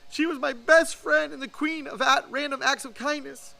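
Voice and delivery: put-on high, pleading child's voice, on the verge of tears; a small boy fighting back tears; tearful boy. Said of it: "Holding back tears, Gus stammered".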